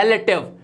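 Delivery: रिलेटिव as "REL-uh-tiv"; correct pronunciation. Shown as a demonstration. Teacher models for learners, रिलेटिव said "rel-uh-tiv". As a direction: open e, stressed first in रिलेटिव. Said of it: "ये होता है".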